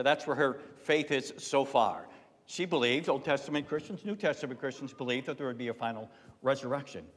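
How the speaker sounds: usual speaking voice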